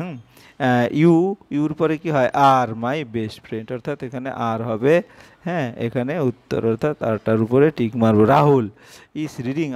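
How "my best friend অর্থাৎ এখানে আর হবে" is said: normal talking voice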